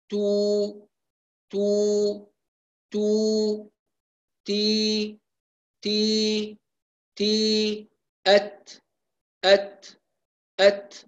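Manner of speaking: تدريب نطق التاء; correct ta drill. Shown as a demonstration. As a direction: drill تو and تي and أت lightly, no extra air. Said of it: "هكذا تكون التاء".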